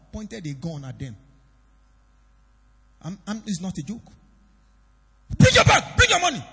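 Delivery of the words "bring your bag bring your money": loud, high armed robber shout; screaming robber demanding the bag; robber's shout. Retold or imitated. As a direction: bark it like a robber with a gun.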